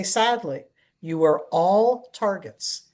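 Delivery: normal talking voice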